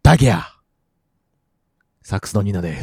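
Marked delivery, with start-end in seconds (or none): 0.0-0.4 Nagoya dialect cry. Acted. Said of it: "地元のやつが出てしまった".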